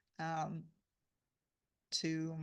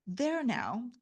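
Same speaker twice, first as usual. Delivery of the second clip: raised, triumphant crow; triumphant cry; 5 semitones higher, 6 dB louder than usual. A narrator's reading. Boy crowing to his governess, triumphant. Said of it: "cried Tom triumphantly".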